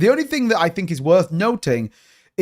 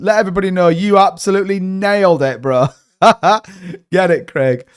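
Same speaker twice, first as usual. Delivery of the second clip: ordinary talk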